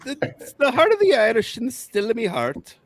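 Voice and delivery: high hammed-up stage Irish accent; high mock Irish lilt. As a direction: overdo the cliché Irish brogue. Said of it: "I hate you".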